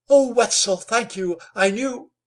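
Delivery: high, loud, breaking thanks; a young woman thanking her rescuer, a touch of voice breaking. A narrator's reading. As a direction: let the voice crack at the end. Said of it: "Nell's voice broke".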